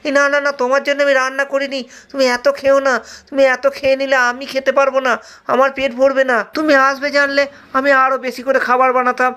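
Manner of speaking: high, fast whiny complaint; a greedy disciple sulking over lost food; whining disciple. Acted. Disciple whining at Rama for eating everything, high and fast.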